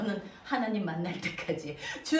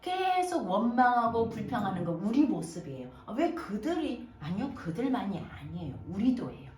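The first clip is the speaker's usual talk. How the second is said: normal talking voice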